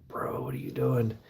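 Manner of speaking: urgent whispered question. Retold or imitated. Whispering to his bandmate on the back porch, urgent and hushed. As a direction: hiss it so she can't hear.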